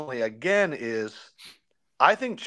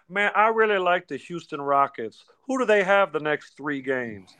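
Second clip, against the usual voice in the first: loose Barkley impression, higher; lightly voiced Charles Barkley; imitating Chuck; 4 semitones higher than usual. Quoting Chuck Barkley in podcast.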